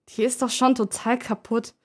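ordinary delivery

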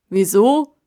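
tiefe, laute Baumstimme; alter Baum. Acted. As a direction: ratlos zurückfragen. Kasta wiederholt stockend. (tiefe Stimme des Baums)

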